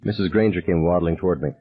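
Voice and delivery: plain reading voice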